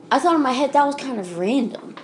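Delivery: ordinary delivery, nothing marked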